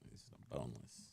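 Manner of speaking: soft whisper. Real, not acted. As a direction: whisper it to the dog. Cooing over the boneless dog.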